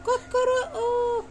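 high, slow mock rooster crow. Mother crowing like a rooster for her baby, high and slow.

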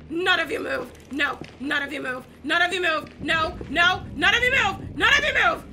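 from firm to a high, loud shouted order; rising command. Real, not acted. Player ordering mannequins to freeze, escalating shout. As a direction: build until you're yelling at them.

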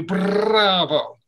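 bravo with a trilled r; rolled r bravo. Shown as a demonstration. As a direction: roll the r in bravo with the tongue. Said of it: "roll your ra's with your tongue".

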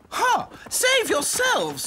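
high haughty doll voice; snooty little princess. A narrator's reading. Princess dolls.

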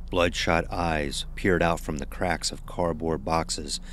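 ordinary delivery

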